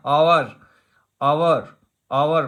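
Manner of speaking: आवर begun on an open "aa", no h; silent H. Shown as a demonstration. Teacher shows how hour is spoken, आवर with no h sound. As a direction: say आवर as "aa-war", the h dropped. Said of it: "silent होता है".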